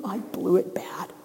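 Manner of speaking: soft whispered confession; whispered regret. Acted. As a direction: admit it under your breath. Peter's inner regret.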